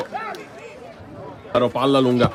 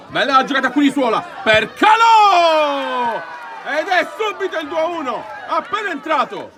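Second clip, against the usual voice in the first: breathless italian goal commentary; 8 semitones higher than usual. Real, not acted. Goal scored, live commentary.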